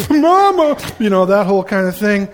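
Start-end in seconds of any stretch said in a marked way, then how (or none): 0.1-0.8 put-on much higher, louder crying wail of a two-year-old; imitating a sobbing toddler calling her mother; toddler wailing for mom. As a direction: wail for Mama like a toddler mid-meltdown. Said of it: "she's just blubbering".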